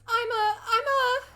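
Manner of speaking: high, loud, faltering stammer near tears; flustered. A narrator's reading. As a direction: she can't find the word. Alice flustered.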